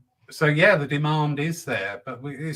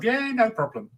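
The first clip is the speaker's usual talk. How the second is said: high-pitched, breezy mock agreement; hammed-up cheerful willingness; breezy over-eagerness; 11 semitones higher than usual. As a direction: act out saying yes to anything. Mocks agreeing to absurd requests.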